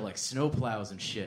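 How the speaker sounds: ordinary talk, nothing marked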